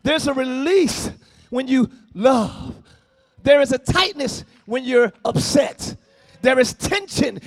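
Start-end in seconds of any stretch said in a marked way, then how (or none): none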